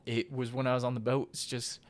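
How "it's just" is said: emotional hush. Real, not acted. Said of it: "I don't mean to get emotional".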